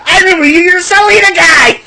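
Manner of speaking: the wrestler spotting the Salida singer, high mock voice; Alberto Del Rio impression. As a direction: ham up a wrestler greeting a fan. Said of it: "Imagine Alberto Del Rio came back".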